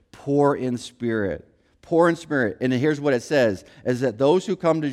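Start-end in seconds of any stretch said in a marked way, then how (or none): 0.1-1.5 slow, low emphasis. Shown as a demonstration. Preacher repeating the key phrase to the congregation, slow and low. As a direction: drop low and slow down on poor in spirit. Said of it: "repeated for emphasis".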